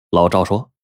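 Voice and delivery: ordinary delivery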